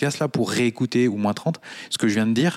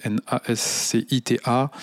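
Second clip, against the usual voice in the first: normal talking voice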